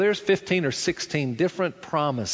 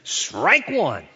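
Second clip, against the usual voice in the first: umpire call; 3 semitones higher, 3 dB louder than usual. Retold or imitated. Umpire calls strike.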